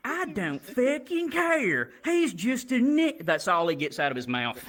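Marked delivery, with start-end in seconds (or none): 0.1-3.3 put-on high, slow, sulky kid voice; whiny kid. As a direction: pout it out like a bratty kid. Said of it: "And he's like".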